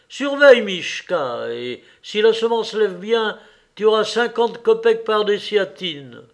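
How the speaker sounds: high, loud, encouraging instruction; brisk master's orders. A narrator's reading. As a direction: tell the farmhand clearly and emphatically. Master giving orders to Mishka.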